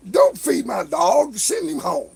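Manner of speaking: slow, loud exasperated holler; mock yell at neighbors. Man yelling at his neighbors to send the dog home, slow loud holler. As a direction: call it across the yard, slow and loud.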